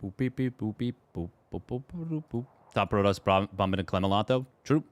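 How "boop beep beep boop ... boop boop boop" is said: soft little vocal boops and beeps; idle boop-beep noises. Real, not acted. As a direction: make soft electronic-style beeps with your mouth. Idly making beep noises to himself.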